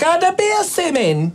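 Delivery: high Caribbean Rasta voice; a hammed-up Rasta guy; Rasta accent. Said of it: "it's like my Rasta guy".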